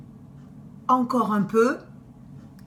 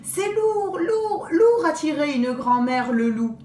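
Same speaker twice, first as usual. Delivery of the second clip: put-on straining girls; 5 semitones higher than usual.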